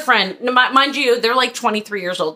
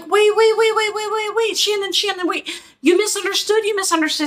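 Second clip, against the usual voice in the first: much higher, frantic mock pleading; panicked pleading; 11 semitones higher than usual. Stylists beg the filming client to stay, frantic and high. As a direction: imitate them scrambling to stop her.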